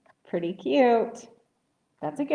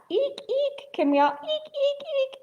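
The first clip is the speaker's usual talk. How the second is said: high voice. Shown as a demonstration. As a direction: squeak high like a bat for children to copy. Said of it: "in a high voice".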